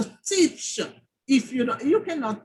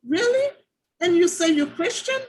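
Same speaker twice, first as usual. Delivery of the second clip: high, loud incredulous "Really?"; mock disbelief; 6 semitones higher, 5 dB louder than usual. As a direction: throw it back at them in disbelief. Incredulous retort to complainer, retold.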